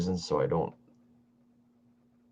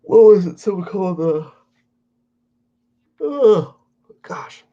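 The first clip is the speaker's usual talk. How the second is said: yawning; 11 semitones higher, 12 dB louder than usual. Real, not acted. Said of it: "like I am yawning".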